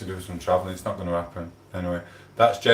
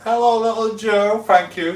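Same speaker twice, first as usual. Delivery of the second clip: high sing-song greeting; 12 semitones higher, 5 dB louder than usual. Acted.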